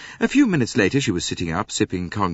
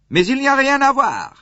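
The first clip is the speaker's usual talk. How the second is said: higher, louder French protest; harassed French conductor; 8 dB louder than usual. A narrator's reading. Conductor pushes the crowd back, loud and French. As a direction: hold them back in French. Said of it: "he said".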